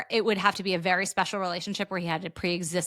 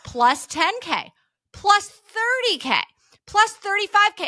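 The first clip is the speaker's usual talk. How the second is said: climbing higher and louder with each number; excited trader watching profits climb, getting higher; rising excitement; 10 semitones higher, 4 dB louder, slower than usual. Retold or imitated. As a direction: build the thrill as the profit grows.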